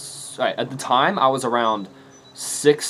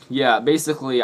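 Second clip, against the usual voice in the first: ordinary delivery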